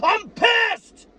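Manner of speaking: furious fan yelling at critics; full angry shout. Real, not acted. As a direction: let it explode at the top of your voice. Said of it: "now I'm gonna fucking yell".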